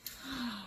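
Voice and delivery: quiet surprised gasp; soft gasp. Acted. Reader gasping at the picture, soft gasp. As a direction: gasp softly in wonder.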